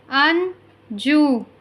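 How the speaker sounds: very slow, stretched Anju; slow careful model word. Shown as a demonstration. Teacher modelling Anju slowly for listening pupils. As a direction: draw out Anju for the class. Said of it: "listen to your teacher say the following words".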